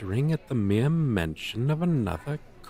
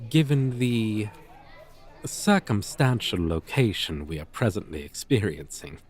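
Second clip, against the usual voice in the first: usual speaking voice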